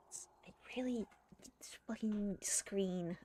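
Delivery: under-breath muttered curse; hissed to herself, annoyed; whispered curse. Real, not acted.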